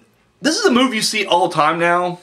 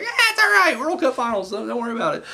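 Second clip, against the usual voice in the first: very high at first, then softer dismissive reassurance; mock referee; 7 semitones higher, 4 dB quieter than usual. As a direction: wave it off in a squeaky, high referee voice. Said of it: "there's like".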